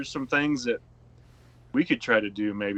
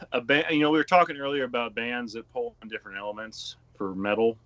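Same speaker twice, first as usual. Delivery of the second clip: usual speaking voice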